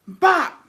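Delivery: high sharp "Bop" for a hit; mock punch sound. Husband hitting her, imitated smack sound. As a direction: make the noise of the punch landing. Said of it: "And he goes".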